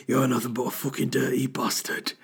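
sultry phone-line purr instead of menace; Game of Thrones voice. Shown as a demonstration. Repeating his Game of Thrones line, it comes out like a sex line. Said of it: "I actually sound like a woman on a sex line".

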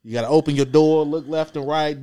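normal talking voice

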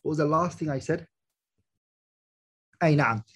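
ordinary talk

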